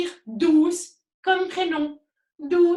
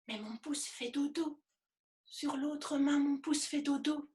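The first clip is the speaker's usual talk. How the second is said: whispering over a crib; 11 dB quieter than usual. Pretend. Thumb asleep, hushed voice.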